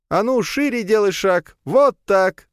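commanding Leya. Acted. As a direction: bark orders at the zombie. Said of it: "командовала Лея".

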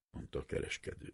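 ordinary delivery

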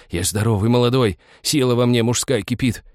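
insistent plea climbing in pitch; anguished, mounting persuasion; desperate pleading. A narrator's reading. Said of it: "тоскливо шептал странник".

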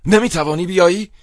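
high, loud shout of disbelief. A narrator's reading. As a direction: cry out in outraged astonishment. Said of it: "فریاد زدم".

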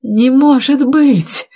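high, drawn-out cry of disbelief; shocked cry. A narrator's reading. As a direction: cry out in slow, stunned disbelief. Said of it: "вскрикнул Виталий". (потрясённый вскрик)

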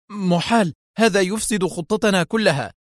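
high-pitched heated protest; agitated outburst. A narrator's reading. Kwaleski interrupting the director, agitated. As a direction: cut in with heated objection. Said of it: "قاطعه كواليسكي في انفعال".

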